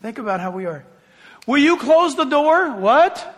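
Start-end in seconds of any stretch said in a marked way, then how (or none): 1.5-3.3 exasperated parent, louder and higher; raised voice. Acted. Parent ordering a kid who ignored them, raised voice. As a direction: raise your voice so the kid hears. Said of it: "We have to raise our voice for some".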